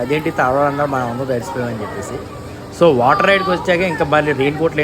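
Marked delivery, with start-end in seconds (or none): none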